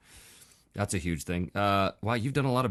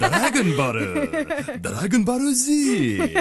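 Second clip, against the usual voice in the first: very high, slow mock Japanese accent; 14 semitones higher, 6 dB louder, much slower than usual. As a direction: draw out Doragon Boru with a laugh. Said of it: "as though it was said in a Japanese accent".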